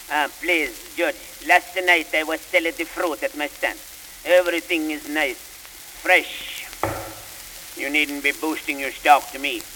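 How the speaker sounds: broad stage Italian fruit vendor pleading; pleading with the judge in a thick Italian accent. Acted. Fruit vendor pleading his case to the judge, Italian accent.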